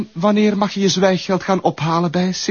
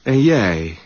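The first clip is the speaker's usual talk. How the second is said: cold threat; 3 semitones lower, slower than usual. Acted. Blackmailer threatens slowly.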